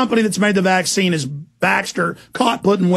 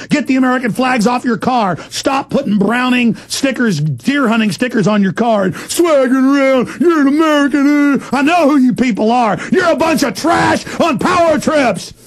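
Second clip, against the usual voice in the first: angry shout; 6 semitones higher than usual. Real, not acted. Ranting at enforcers.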